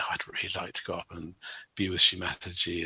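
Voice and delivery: softly voiced thought, hushed; quiet inner thought. Young yogi secretly wishing to see Mother, hushed. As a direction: let the wish slip out under his breath.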